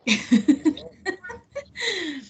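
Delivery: high, light burst of laughter; laughing. Real, not acted. Amused by frying papas joke.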